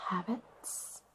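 ordinary talk, nothing marked

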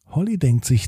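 usual speaking voice

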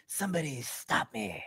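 lightly voiced, slow Jim Carrey catchphrase; a hint of Jim Carrey hamming it up; awkward first try at a Jim Carrey bit; Jim Carrey impression. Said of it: "I would try to do impressions".

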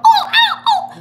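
high, loud yelping mock cries; mock animal cries. Wife mimicking the night animal noises, high yelps. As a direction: yelp out like a hurt animal.

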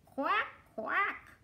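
two high quacks; duck quacking. Acted. Reader plays the duck for children, high quacking. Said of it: "called the duck".

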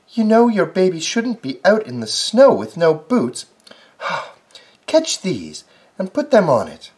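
scolding mouse. Acted. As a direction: tell him off like a fussy mouse. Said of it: "scolded Missus Field Mouse".

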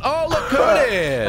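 high, mocking look who it is; gleeful taunt. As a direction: gloat over the downed rival. Mocking discovery of rival.

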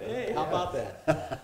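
slow, amused, with a laugh; chuckling. Real, not acted. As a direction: savor the joke through a chuckle. Chuckling repeat of the joke.

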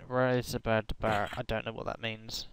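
ordinary talk, nothing marked

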